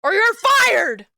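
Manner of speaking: shouted threat. Acted. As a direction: bark it like an angry boss firing someone. Shouted threat, comic bit.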